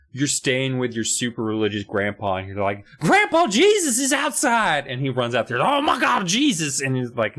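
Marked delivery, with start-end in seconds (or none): 3.0-4.9 high, drawn-out holler; mock excited calling. Kid tells super religious grandpa Jesus is outside, mock excited calling. 5.6-6.8 high-pitched, overjoyed exclamation; mock awe. Grandpa runs out expecting to see Jesus, mock awe.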